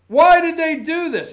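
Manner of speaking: high, loud, laughing mock outrage; mock outraged question. Host mocking the morning anchor's outrage, laughing. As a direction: ham up the anchor's indignant why. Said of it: "what they were saying this morning".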